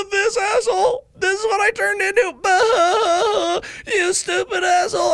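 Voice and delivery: shrieking, sky-high mock sobbing voice; loud, cartoonishly high tearful taunt; hysterical past self. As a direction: wail scornfully at your future self, hammed-up. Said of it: "Past me is looking at me like".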